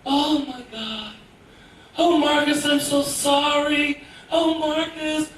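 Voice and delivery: slow, high apologetic woman's voice; flustered single mom apologizing to her son, slow; flustered mother. Retold or imitated. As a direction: coo out apologies to the kid. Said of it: "just a voice like".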